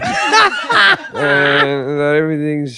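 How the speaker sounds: mock-sheepish mumbled send-off, half laughing; drawn-out, awkward sheepish imitation. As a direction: imitate someone sheepishly trailing through good wishes. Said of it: "like, all sheepish".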